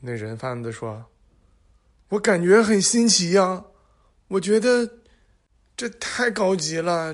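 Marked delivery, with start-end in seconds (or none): 2.1-7.1 put-on high, loud childlike wonder; excited. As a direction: gush like an amazed fool. Dim trafficker marvels.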